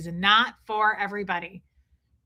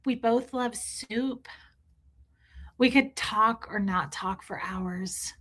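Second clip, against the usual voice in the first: dreamy ditzy wife; 3 dB quieter, slower than usual. Retold or imitated. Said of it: "And she's like".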